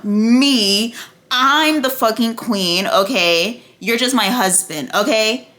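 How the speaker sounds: ME loud and held, QUEEN drawn out; hammered emphasis. Retold or imitated. Queen putting her husband in his place, ME hammered. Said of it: "she kept hammering in".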